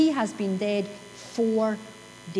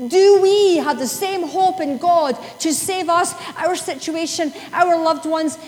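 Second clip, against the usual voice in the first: louder, higher pulpit push; preaching; 5 semitones higher, 5 dB louder than usual. Acted. Preacher pressing the congregation on hope, loud and high. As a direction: drive the question home to the room.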